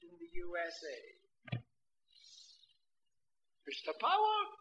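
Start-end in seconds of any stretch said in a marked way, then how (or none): 3.7-4.5 put-on high old man calling; an old man addressing the preacher; high old man's Mister Power. Old man voiced in story.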